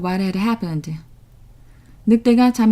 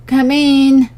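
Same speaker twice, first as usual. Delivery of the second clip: croaky, raised wolf voice posing as grandma; 5 semitones higher, 6 dB louder than usual. A narrator's reading. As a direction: croak it out like a sick old woman. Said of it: "in a croaky voice".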